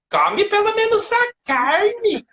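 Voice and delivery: high, slow nagging mother; sing-song it like every nagging mom. Retold or imitated. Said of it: "toda mãe fala assim".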